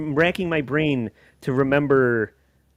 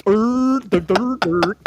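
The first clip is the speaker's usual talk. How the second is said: high, loud dopey noises; exaggerated dumb-sounding mimicry; mocking imitation; 8 semitones higher, 3 dB louder, slower than usual. Co-host making fun of the kid's accent, dopey mimicry. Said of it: "He sounds like this".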